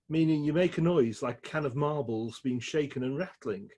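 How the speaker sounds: ordinary delivery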